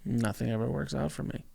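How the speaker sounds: quiet, defeated murmur; gloomy. Shown as a demonstration. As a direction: say it like nothing goes right. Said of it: "Ooh, you can feel it".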